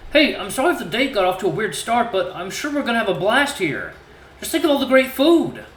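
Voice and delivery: overly cheerful, trying to please; awkward suitor. Acted. Voices Cecil reassuring.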